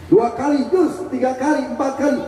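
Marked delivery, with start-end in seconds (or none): 0.7-1.1 a short, high "cus" for the bite; snake strike hiss. Retold or imitated. Preacher to the audience, imitating a snake bite with a hiss.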